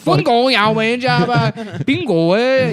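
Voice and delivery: high, loud, slow made-up Chinese; hammed-up gibberish Chinese, drawn out; fake Chinese. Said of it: "I speak fake Chinese".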